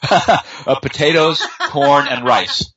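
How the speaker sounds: fighting back a giggle while listing foods; stifling a laugh. Real, not acted. Telling the waiter his fake allergy, half laughing. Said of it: "it's hard not to laugh".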